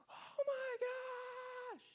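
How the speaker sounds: put-on very high, drawn-out squealing cry of a child; kid's excited shout. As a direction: belt it out like an excited little boy finding something. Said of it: "I hear him go like".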